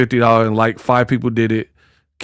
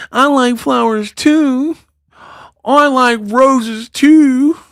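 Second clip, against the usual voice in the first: put-on high, slow whiny girl voice; mocking girly voice; 10 semitones higher, slower than usual. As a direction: whine it like a needy girlfriend.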